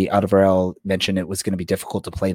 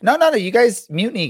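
excited protest; 12 semitones higher, 4 dB louder than usual. Real, not acted. Jokingly protesting mutiny.

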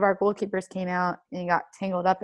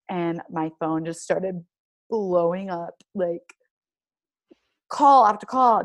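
ordinary talk

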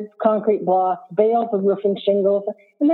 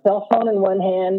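ordinary delivery

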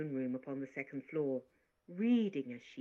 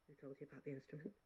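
much softer, fast; quiet cold question; 15 dB quieter, much faster than usual. Acted. Teacher questions coldly.

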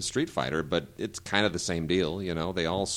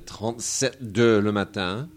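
put-on careful French accent; French pronunciation; 2 semitones higher, 5 dB louder than usual. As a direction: say the title the French way. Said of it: "He's talking French".

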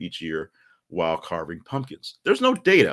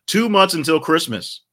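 announcer proclamation; 10 semitones higher, 8 dB louder than usual. Real, not acted. Announcing countdown.

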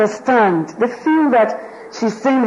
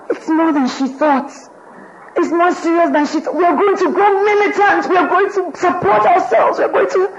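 much higher, rising to a fervent cry; fired up; 8 semitones higher than usual. Retold or imitated. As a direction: whip up the women, high and fervent. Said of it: "Missus Jakes grew wild".

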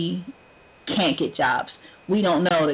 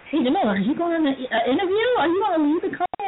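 higher, quick prying voice; imagined nosy question; 10 semitones higher than usual. Retold or imitated. Imagined boss prying about an interview, quick high mock voice. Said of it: "But she didn't ask".

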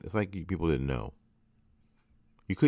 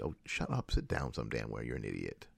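contemptuous dismissal; 8 semitones lower, 9 dB quieter than usual. Pretend.

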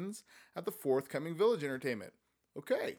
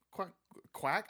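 mock quack; 4 semitones higher, 3 dB quieter than usual. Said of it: "I said quack for a chicken".

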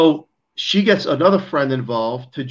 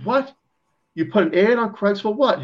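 incredulous disbelief; 8 semitones higher than usual. Retold or imitated. Incredulous reaction to friend's ad.

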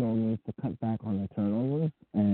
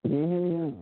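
garbled audio; 7 semitones higher than usual. Real, not acted. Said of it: "That's exactly how you sound".

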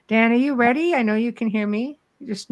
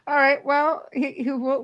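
ordinary delivery

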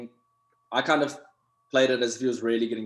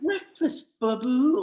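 chirpy wife; 12 semitones higher, slower than usual. Retold or imitated. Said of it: "and then they're like".

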